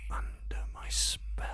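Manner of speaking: breathy close whisper; whisper in left ear. Acted. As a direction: whisper right into one ear. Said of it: "and whisper into your ear".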